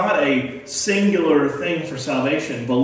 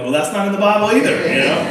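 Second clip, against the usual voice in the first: retorting; 6 semitones higher, 5 dB louder, faster than usual. Retold or imitated. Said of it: "I'm like".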